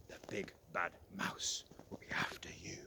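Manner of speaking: hushed, ominous whisper; spooky whisper. Acted. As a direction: frighten the little one gently. Scary warning about mouse.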